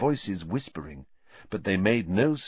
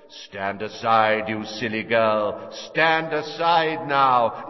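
cold commanding voice; 3 dB louder, slower than usual. A narrator's reading. Killer orders mother aside.

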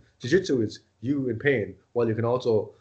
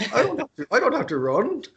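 high-pitched mock protest; indignant protest; 9 semitones higher, 4 dB louder than usual. Son objecting to dad about running, high indignant protest. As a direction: protest it high, acting out your own objection. Retold argument with his dad.

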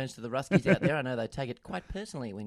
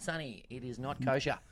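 ordinary delivery

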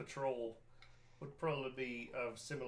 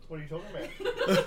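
laughing; 4 semitones higher, 9 dB louder than usual. Real, not acted. Group bursts out laughing.

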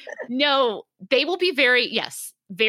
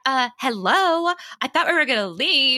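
higher-pitched, impatient wife; bitchy wife; 5 semitones higher than usual. Acted. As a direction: play the annoyed wife waiting to leave. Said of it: "like the bitchy wife".

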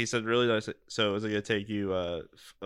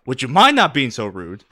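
mock chef rebuke; 11 dB louder than usual. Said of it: "and then he went".

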